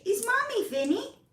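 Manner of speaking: high, sing-song pet voice. Real, not acted. Owner cooing her name to her cat, high coo.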